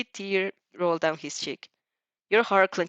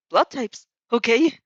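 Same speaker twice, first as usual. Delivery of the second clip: urgent; 4 semitones higher, 6 dB louder, slower than usual. A narrator's reading. Urgent repeat.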